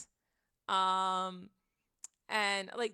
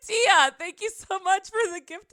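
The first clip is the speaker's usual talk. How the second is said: high, loud gushing gratitude; flustered thanks; 10 semitones higher, 11 dB louder than usual. Real, not acted. Streamer thanking a viewer for gifts, overwhelmed and excited. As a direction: gush thanks through giddy shock.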